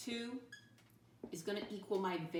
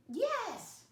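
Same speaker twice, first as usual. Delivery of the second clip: excited praise; 4 dB louder than usual. Real, not acted. Praising kids' answer.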